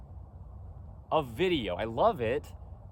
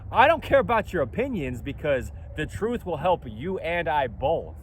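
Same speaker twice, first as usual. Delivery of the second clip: ordinary talk, nothing marked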